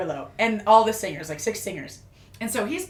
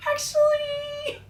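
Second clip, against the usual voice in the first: high drawn out; much slower than usual. Retold or imitated. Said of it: "And I was like".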